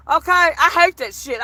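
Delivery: ordinary talk, nothing marked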